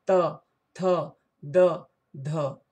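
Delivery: त थ द ध each said separately, tongue against the teeth; dental series. Shown as a demonstration. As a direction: sound out त थ द ध slowly one by one. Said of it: "दांत में जिह्वा सटता है". (दंत्य वर्ण त थ द ध अलग-अलग बोलकर)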